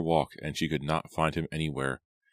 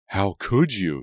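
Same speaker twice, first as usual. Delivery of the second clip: higher, loud, outraged accusation; raging; 4 semitones higher, 6 dB louder, slower than usual. A narrator's reading. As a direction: rage at a sister. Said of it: "she raged at Kate again".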